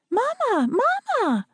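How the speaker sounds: put-on high, excited child's squeal; little girl squealing Mama. A narrator's reading. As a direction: squeal up at mother like a small girl. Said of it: "Delia's voice squealed up".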